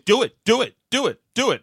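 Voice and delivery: imitating a pushy moderator; mock debate moderator. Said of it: "Joe Biden and Chris Wallace saying".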